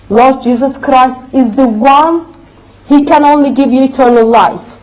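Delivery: ordinary talk, nothing marked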